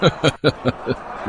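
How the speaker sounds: a soft, scornful chuckle; quiet taunting laugh at a puny rival; mocking laugh. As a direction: laugh at the little mosquito. Wrestler mocks Bhola.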